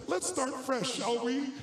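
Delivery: a hint of a warm, disarming president; friendly Reagan. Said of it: "turned back around and said".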